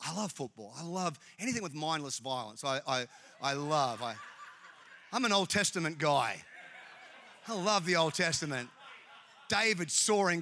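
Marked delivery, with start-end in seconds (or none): none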